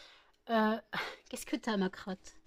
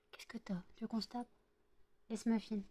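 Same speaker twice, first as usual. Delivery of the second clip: whispered, much softer questions to the dog; soft hushed teasing; 9 dB quieter than usual. Real, not acted. Owner quietly teasing her dog, whispered teasing.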